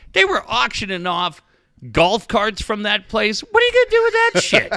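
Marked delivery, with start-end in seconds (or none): 3.4-4.7 much higher, fast mocking squeal; scoffing disbelief. Host ridiculing fans buying golf carts, high fast scoff.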